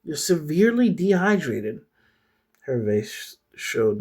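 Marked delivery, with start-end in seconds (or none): 0.0-1.8 higher-pitched, urgent character voice; voiced worried friend; concerned friend. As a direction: lift the voice, urge them to wake up. Clarice's line voiced in story.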